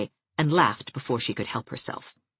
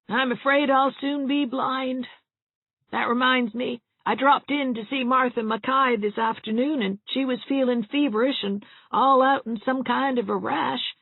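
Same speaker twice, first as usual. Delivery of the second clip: put-on higher, fretful voice of a doom-saying old woman; gloomy old cousin; 12 semitones higher, 3 dB louder than usual. A narrator's reading.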